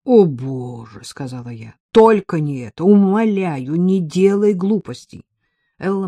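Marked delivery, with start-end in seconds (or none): 0.1-1.0 dismayed exclamation. A narrator's reading. Friend dismayed at the postcard idea. 1.9-5.3 louder, higher, drawn-out alarm; loud imploring. A narrator's reading. Friend begs Ella not to write, loudly imploring.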